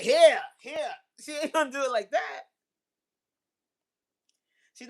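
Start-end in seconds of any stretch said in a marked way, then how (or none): 0.0-0.9 higher, louder pushy offer; blunt mock offer. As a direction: shove it at him, no subtlety. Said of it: "She won't be like".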